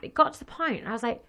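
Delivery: ordinary talk, nothing marked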